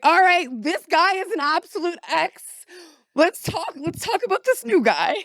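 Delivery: put-on high, loud girly gossip voice; mock excited gossip. She mimics herself gushing to the AI, giddy. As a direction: squeal like a friend spilling tea. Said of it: "I'm like".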